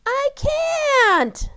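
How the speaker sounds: high, loud desperate shout. Acted. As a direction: scream the refusal. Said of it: "shouted Amy".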